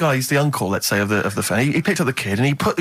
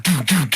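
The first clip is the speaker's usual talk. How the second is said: imitating techno. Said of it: "hardcore German techno".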